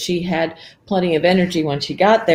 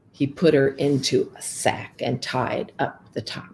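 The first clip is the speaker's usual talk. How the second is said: ordinary delivery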